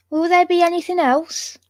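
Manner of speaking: high-pitched West Country accent attempt; attempted West Country maid. A narrator's reading. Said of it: "I'm gonna stop trying".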